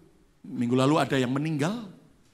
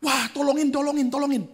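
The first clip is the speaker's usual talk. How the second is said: high, loud pleading cry for help; retold cries for help; 13 semitones higher, 3 dB louder than usual. Church member on a bike calling for help, high and pleading. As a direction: call out for help as if desperate. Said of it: "sambil teriak-teriak".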